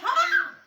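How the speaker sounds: sharp, high shout of proclamation; loud exclaimed "ha". Retold or imitated. Men of God announcing a prophecy, shouted.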